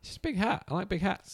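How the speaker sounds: high goofy squeaky voice; silly high voice. Pretend. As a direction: squeak it out like a cartoon. Goofy big hat banter.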